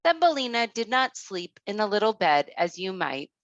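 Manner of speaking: plain reading voice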